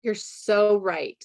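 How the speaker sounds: usual speaking voice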